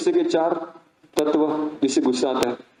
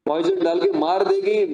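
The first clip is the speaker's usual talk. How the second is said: pitched way up and slower, mocking disbelief; incredulous scolding; 13 semitones higher than usual. A brother scoffing at his sister's poison plan, incredulous. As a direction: scoff at her silly plan.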